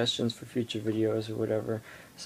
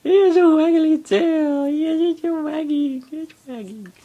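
dog voice; 9 dB louder than usual. Real, not acted. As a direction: coo at the puppy up high. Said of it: "That's my dog voice".

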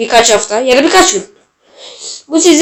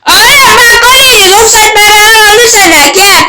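yelling at the game; 8 semitones higher than usual. Real, not acted. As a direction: shout at the screen after conceding.

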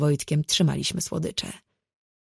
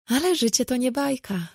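higher-pitched, resigned sighing remark; 8 semitones higher than usual. A narrator's reading. Friend commiserating about married life, sighing. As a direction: sigh it out in mild resignation. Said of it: "westchnęła".

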